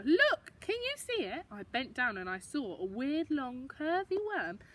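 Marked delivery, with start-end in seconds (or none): 0.0-1.5 Gran pointing out. Acted. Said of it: "Gran pointed at the flower".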